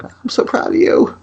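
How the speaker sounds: very high, breathless whisper, slow, hammed-up tearful; mock choked-up pride. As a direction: squeak it out like a dad fighting back tears. Dad acting overcome with pride.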